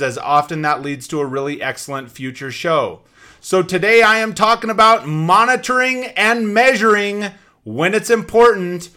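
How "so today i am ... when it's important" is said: slow hyped announcer tease; energy drink ad. Acted. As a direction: drag out right now. Host teases episode topic.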